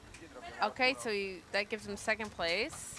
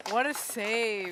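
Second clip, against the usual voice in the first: excited relief; 4 semitones higher, 7 dB louder, slower than usual. Real, not acted. The diver passes protocol.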